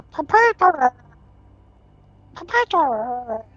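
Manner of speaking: high, bubbling muffled call; underwater voice. Acted. Tumor inside the tube calls out, underwater voice. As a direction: call out as if through water, drawn out at the end. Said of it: "as if underwater".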